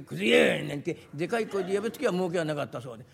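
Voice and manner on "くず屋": big, bossy yell from afar; haughty loud call. Acted. Customer summoning the junk man from afar, loud and haughty. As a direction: holler for the ragman, lording it over him. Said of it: "威張ったように遠くの方からでかい声で".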